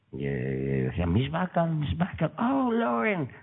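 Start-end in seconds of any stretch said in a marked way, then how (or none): 1.1-3.3 high-pitched mock fans calling out; fawning party guests calling. Guests hailing Lauren Bacall as she passes, high mock calling. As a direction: call after the star in a high put-on voice.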